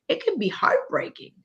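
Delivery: real tears catching in the voice, pitch jumping up; voice breaking. Real, not acted. Host moved to tears by the guest's story, voice breaking. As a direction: let the voice crack as the tears come. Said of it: "you're gonna make me cry".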